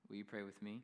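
ordinary talk

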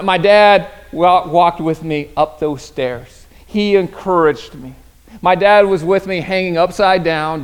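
normal talking voice

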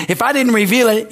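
ordinary delivery

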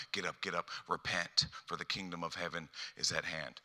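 hushed, repeated words of comfort; tender reassurance. Acted. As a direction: speak close and low, lifting someone up. God drawing close, gently reassuring.

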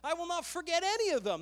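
crying out to God. Acted. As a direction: pour it out like David crying to the Lord. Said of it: "is crying out from his heart".

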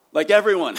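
bursts out loud and high; emphatic shout. Real, not acted. Preacher shouting to the congregation about the tax collector, loud and high. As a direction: nearly shout the answer. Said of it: "emphatic answer".

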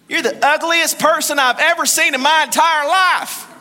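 high, loud, punched-out parrot insult. Acted. Parrot insulting a man in a pet store, brash.

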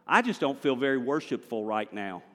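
normal talking voice

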